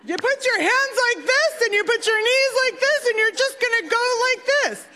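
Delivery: high, strained imitation of dad repeating himself to get through; mock-loud dad. Dad repeating dive instructions to his kid, mock-loud. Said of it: "maybe I didn't say it loud enough".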